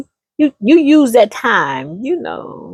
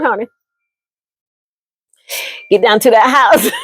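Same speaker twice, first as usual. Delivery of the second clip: ordinary talk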